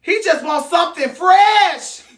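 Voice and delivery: high, loud complaint; loud whiny protest; exasperated cry. Retold or imitated. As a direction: plead for something fresh.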